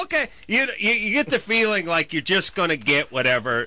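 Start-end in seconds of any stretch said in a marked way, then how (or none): 0.0-0.3 high-pitched, hurried caricature of an order-taker; squeaky mock phone clerk; dismissive order-taker. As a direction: chirp an oblivious okay. Mimics heedless restaurant order-taker.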